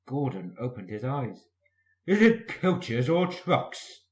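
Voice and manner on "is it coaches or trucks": slow query. A narrator's reading. Gordon asks sleepily.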